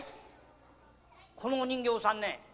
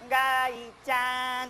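slow, gentle call of the name; tender drawn-out ガイちゃん; much slower than usual. Shown as a demonstration. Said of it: "もっと優しい声で".

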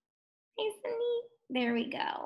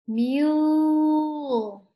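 "Mule" stretched out, higher; slow; 4 semitones higher, 4 dB louder than usual. Shown as a demonstration. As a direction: hold the long U in "Mule", raised in pitch. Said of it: "Can you say that slowly?".

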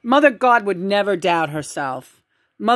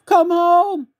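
mock pleading mother; 11 semitones higher, 5 dB louder than usual. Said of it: "her mother shows up on the show".

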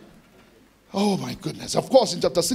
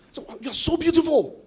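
gushing compliment; 10 semitones higher than usual. Retold or imitated. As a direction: pay an over-the-top compliment in a raised pitch. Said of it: "a young lady that I said to".

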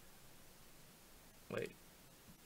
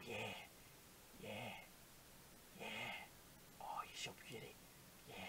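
very soft, low seductive murmur; mock-sultry whisper; 5 semitones lower, 14 dB quieter than usual. Player flirting with the face being painted, mock-sultry whisper. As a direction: whisper it like pretend pillow talk.

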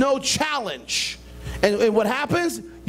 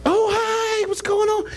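mock cheery greeting; 11 semitones higher, slower than usual. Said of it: "it's like".

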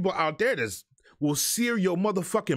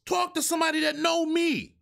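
angry shout; 10 semitones higher than usual. Acted. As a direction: shout it much higher. Repeats line, then shouts it.

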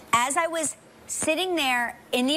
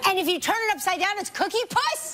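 incredulous; 8 semitones higher than usual. Real, not acted. Disbelief audience doesn't know.

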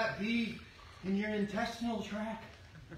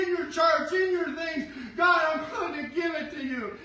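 loud, higher preaching into a shouted prayer; preaching at full force; 5 semitones higher, 4 dB louder than usual. Acted. Pastor leading the church into a surrendering prayer, shouting. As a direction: proclaim it, then pray it out loud.

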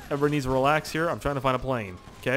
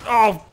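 sudden loud, high "oh"; startled yell; 9 semitones higher, 10 dB louder than usual. Real, not acted. Gamer crashing to a stop, startled yell. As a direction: yelp at an abrupt impact. Abrupt crash while flying in game.

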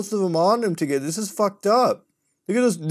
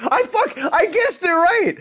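excited outburst; 13 semitones higher, 4 dB louder than usual. Real, not acted.

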